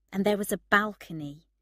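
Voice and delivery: ordinary delivery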